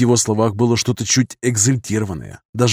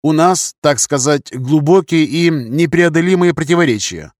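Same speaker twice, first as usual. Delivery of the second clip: higher-pitched courteous officer's voice; polite English captain; 5 semitones higher, 3 dB louder than usual. A narrator's reading. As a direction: explain delicately in a higher, formal voice. Englishman's figure voice, answering the colonel.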